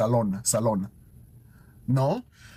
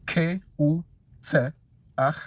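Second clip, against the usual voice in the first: ordinary talk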